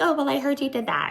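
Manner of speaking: high, quick mock voice; mimicking gossip. Retelling Lisa's accusation to Whitney, high quick mock voice. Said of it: "um, she's like".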